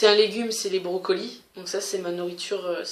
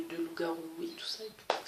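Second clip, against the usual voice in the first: whisper; 2 semitones lower, 14 dB quieter, faster than usual. Pretend. Teasing spooky werewolf topic.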